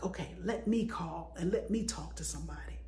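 whispered to herself; soft unvoiced whisper. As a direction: hush it like a private decision. Whispered retold thought.

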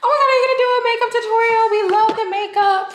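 high, fast gushing fan voice; imitating excited fans. Vlogger mimicking fans begging for a tutorial, gushing. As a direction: squeal it like an eager follower. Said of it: "I got so many questions on".

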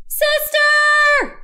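high, loud call for help; shout for sister. A narrator's reading. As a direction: call out to wake your sister. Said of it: "shouted Baby".

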